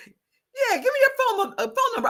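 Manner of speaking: high, laughing retold plea; imitating the ex. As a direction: coax for the number. Ex asking for number.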